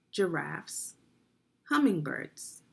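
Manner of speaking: ordinary delivery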